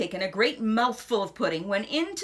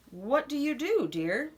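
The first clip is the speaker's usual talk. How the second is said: ordinary delivery, nothing marked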